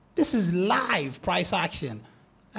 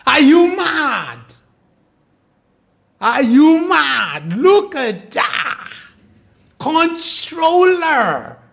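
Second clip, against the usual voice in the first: loud, higher, slow taunting calls; triumphant shout; 6 semitones higher, 12 dB louder, much slower than usual. Real, not acted. Trader taunting doubting viewers, loud and triumphant. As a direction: taunt them, big and slow.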